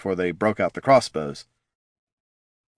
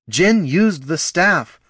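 slow, higher call; yelled; 8 semitones higher, 4 dB louder, much slower than usual. Acted. Loruna calling to Gorm while suspended, yelling. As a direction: call it out slowly across the sky. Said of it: "yelled Loruna".

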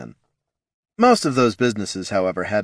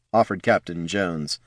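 ordinary delivery

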